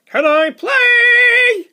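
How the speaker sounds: high, loud, slow trumpeting call; elephant trumpet. Acted. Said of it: "Elephant trumpet".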